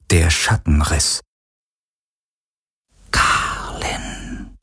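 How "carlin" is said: breathy longing whisper of a name. A narrator's reading. Shadow figure calling Garland's name in a whisper. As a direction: breathe "Carlin" out with no voice. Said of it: "flüsterte er".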